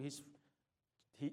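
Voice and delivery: normal talking voice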